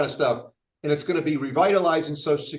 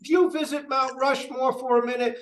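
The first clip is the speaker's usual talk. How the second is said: mock yell; 8 semitones higher than usual. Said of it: "or yell out".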